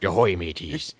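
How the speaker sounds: gruff pirate greeting. Pretend. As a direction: call it out like a pirate captain. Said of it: "Why doesn't he talk like a pirate?".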